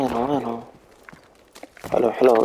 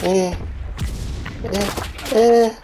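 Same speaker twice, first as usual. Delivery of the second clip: startled question; 10 semitones higher than usual. Real, not acted. Sudden surprise in game.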